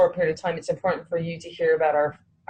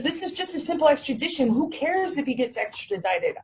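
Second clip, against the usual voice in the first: dismissive lawyer; 8 semitones higher than usual. Retold or imitated. Mimicking the lawyer's dismissive reply.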